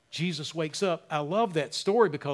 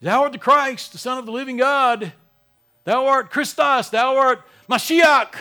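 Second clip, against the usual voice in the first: solemn declaration; 6 semitones higher, 9 dB louder than usual. Pretend. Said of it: "You can say".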